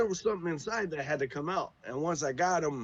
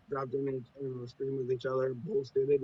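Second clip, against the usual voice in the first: normal talking voice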